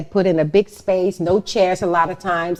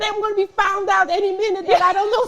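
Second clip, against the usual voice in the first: high, laughing impostor panic; mock panic; 13 semitones higher than usual. Describing her fear of being found out as an actor, high and laughing. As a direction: squeak it out like you're about to be caught. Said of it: "you feel like".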